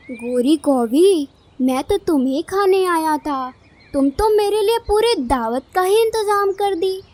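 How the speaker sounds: loud, gloating predator; menacing kite villain. Acted. Kite tells the crow she is his feast, menacingly gloating.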